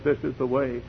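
softly spoken voice from behind; soft call. Acted. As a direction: say it quietly, as a first gentle prompting.

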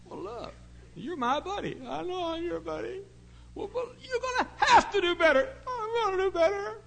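very high, loud, laughing squeak; squeaky mock voice. Preacher hamming up his talk with the boy, squeaky. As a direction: ham up the exchange.

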